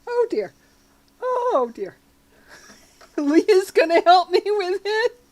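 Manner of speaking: high squeals of laughter; laughing too hard to speak; cracking up at the chat, close to tears. Real, not acted. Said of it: "because I'm laughing so hard".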